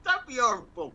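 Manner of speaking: amused half-scold while laughing. Real, not acted. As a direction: tease through real laughter. Laughing at a crude joke.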